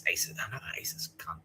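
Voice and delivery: soft, hushed conspiracy aside; conspiratorial whisper. Retold or imitated. Nick telling the spy theory in a conspiratorial hush. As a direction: whisper it like a secret.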